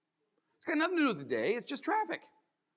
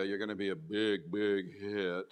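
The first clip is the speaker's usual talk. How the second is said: "big, big hit" said low and sour, no lift on "big"; grumpy delivery; 12 semitones lower, 4 dB quieter, slower than usual. Shown as a demonstration. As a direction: mutter "big hit" like you don't mean it. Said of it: "You lost me at hello".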